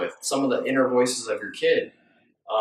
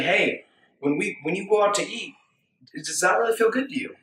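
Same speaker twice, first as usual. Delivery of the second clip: gentle check-in; 7 semitones higher than usual. Retold or imitated. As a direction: ask the inner kid kindly. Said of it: "We're saying".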